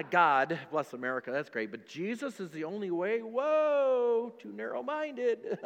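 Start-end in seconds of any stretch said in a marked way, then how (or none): 3.2-5.6 high, slow mocking scoff at the faith. Preacher mimics critics, scoffing high and slow. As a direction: scoff like the world sneering.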